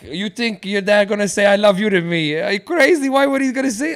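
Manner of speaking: high, loud mock mom, scoffing; hammed-up maternal disbelief with rising pitch; incredulous mom. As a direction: imitate a mother who finds the idea crazy. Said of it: "She's like".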